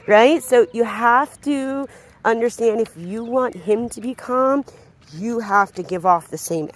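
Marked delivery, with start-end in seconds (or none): none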